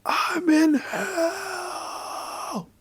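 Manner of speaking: high, drawn-out mock scream; retold scream. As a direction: imitate someone screaming in terror, held back in volume. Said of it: "scream out the top of their lungs".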